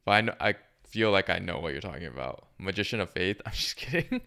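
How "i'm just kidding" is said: laughing. Real, not acted. Laughing off a joke answer.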